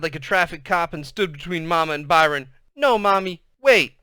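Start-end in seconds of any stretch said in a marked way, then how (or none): 2.7-4.0 put-on high, loud child's cry; desperate little girl; urgent protest. A narrator's reading. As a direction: block mother with a frantic plea. Sister shielding brother from mother.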